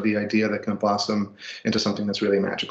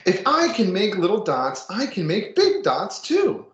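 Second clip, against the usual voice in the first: put-on reading to children; 5 semitones higher than usual. Reads picture book to class.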